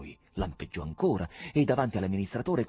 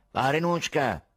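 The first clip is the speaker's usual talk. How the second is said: slow, raised, nasal address; repellent voice; 4 semitones higher, 5 dB louder, slower than usual. A narrator's reading. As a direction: draw the name out obnoxiously. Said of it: "riprese la stessa voce ributtante". (voce ributtante, nasale e strascicata)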